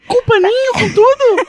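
high, slow put-on voice; high squeaky customer. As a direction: ask like a squeaky tourist.

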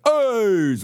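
high, loud exclaimed AIDS; mock Team America shout; stadium shout. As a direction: call it out like to a stadium crowd. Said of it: "like they're at a stadium".

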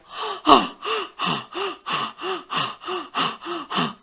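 long run of rapid, high gasps; gasping for air. Retold or imitated. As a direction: pant in shock on surfacing from water. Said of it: "like this".

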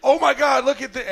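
high, loud burst of retold astonishment; amazed exclamation. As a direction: act out the searchers' shock on spotting the tooth. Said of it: "it was like".

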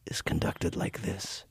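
breathy whispered demo of TV dialogue. Shown as a demonstration. Host mocking TV dialogue by whispering it. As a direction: show how everyone whispers on screen. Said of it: "in a whisper constantly".

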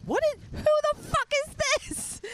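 shrill-high, outraged imitation of someone on the phone; mock outraged caller. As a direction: pitch it way up like a shocked stranger. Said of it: "all of a sudden they're like".

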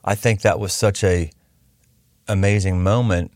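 low, halting voice with long pauses; moved, feeling for the words; a little emotional. Real, not acted. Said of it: "I just started getting a little emotional".

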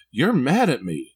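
put-on slow, hurt little-kid voice; imitating his son. Small son tells his dad he's mad, slow childlike voice. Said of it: "He said".

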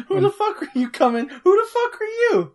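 high laughing outburst. Pretend. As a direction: laugh through the question. Voicing player meeting new villager.

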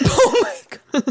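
high, slow laughing through words. Real, not acted. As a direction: crack up at yourself. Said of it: "I actually thought that you were about to do a really good job".

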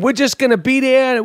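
high, half-hearted Boston accent mimicry; attempted Boston accent. Host mocking the coach's whining, badly attempted Boston accent. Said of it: "I can't even do that accent".

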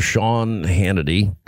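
low, slow radio-host impression; Rush Limbaugh. Host retrying his Rush for a doubtful colleague, deep drawn-out impression. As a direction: draw the name out deep, like a radio announcer. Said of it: "No, this is Rush".

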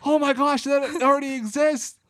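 high-pitched reenacted amazement; excited realization. Host retelling a crossover realization, excited. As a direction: blurt it out like a sudden discovery. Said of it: "And I went".

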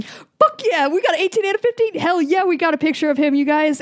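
high, excited whoop of triumph; excited cheer. Pretend. Game master cheering the players' lucky roll, excited. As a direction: celebrate the roll like a win.

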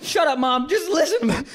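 high, impatient comic retort; mock exasperation. Acted quarrel with mom.

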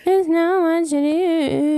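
slow, flat joke version; deadpan slogan. Pretend. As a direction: drag it out like a sad tagline. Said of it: "That's what I just heard".

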